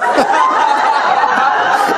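loud, high burst of laughter. Real, not acted. Remembering dad's yelling, laughing out loud. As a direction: laugh hard at the memory.

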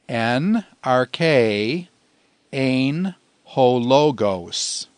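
slow, measured word-by-word Greek recitation. Shown as a demonstration. Teacher introducing a Greek memory verse to students, slowly recited. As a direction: space each Greek word out for learners. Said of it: "Say it with me in Greek".

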